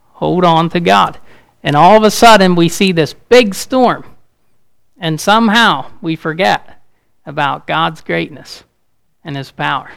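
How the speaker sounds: loud, rising preacher's build; fiery preaching. Acted. Pastor warning the congregation about life's storms, loudly.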